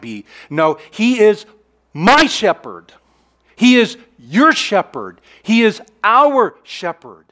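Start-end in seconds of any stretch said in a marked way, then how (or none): 1.9-2.9 my shepherd slow and louder; stress on my. Shown as a demonstration. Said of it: "The possessive here is emphatic". 3.5-5.2 your punched louder; stress on your. Shown as a demonstration. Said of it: "The possessive here is emphatic". 5.4-7.3 our said slow and louder; stress on our. Shown as a demonstration. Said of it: "The possessive here is emphatic".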